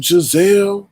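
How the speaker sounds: ordinary talk, nothing marked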